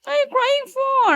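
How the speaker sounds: very high, loud mock wail; high-pitched baby talk. Asking the crying baby why, loud high-pitched baby talk.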